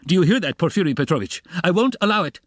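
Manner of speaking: high, loud angry demand; furious, rising outburst; shouted. A narrator's reading. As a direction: shout it as if banging the table. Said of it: "he shouted".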